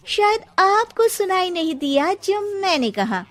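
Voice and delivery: cheeky retort. Acted. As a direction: throw her words back at her.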